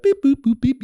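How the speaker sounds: high imitated dialling tones; keypad beeps. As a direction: voice the phone buttons being pressed.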